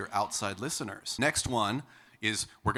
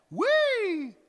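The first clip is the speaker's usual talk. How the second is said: very high, loud Wee! up in the head; 8 dB louder than usual. Shown as a demonstration. Coach squeals a head-voice Wee!. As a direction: let it ring behind the skull. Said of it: "head voice feels".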